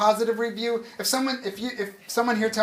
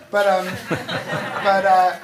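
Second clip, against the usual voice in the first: real laughter breaking up the words; laughing, slowed right down; 6 dB louder, much slower than usual. Real, not acted. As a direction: let the laugh take over. Laughing after a joke.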